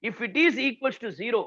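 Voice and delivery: ordinary talk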